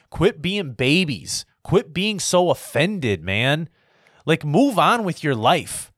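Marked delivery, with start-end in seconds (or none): none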